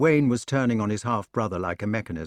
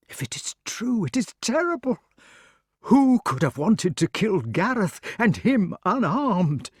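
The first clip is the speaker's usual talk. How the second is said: horrified; 10 semitones higher, 3 dB louder than usual. Acted. King's horrified disbelief.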